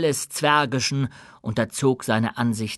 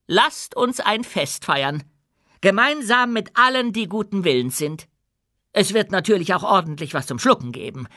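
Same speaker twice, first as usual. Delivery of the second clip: proud, firm queen addressing her subjects; dwarf queen, commanding; 8 semitones higher than usual. Acted. Queen announces a feast.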